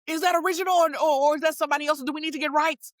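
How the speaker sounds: high, fast and breathless; frantic showrunner, fast and high; excited rush. Retold or imitated. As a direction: blurt it out all in a rush. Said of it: "done ran out from the back".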